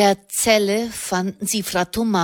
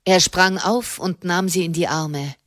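plain reading voice

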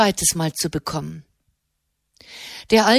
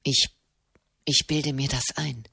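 low, much softer stammering thought; shaken, half to himself, low and soft; a distraught monk doubting his eyes, low and hushed; 8 semitones lower, 6 dB quieter than usual. A narrator's reading. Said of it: "dachte Marquesano verstört".

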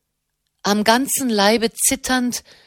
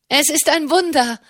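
a brother cries out in amazement; excited cry; 6 semitones higher than usual. Acted. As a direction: call out the miracle to the others. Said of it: "rief einer der Brüder".

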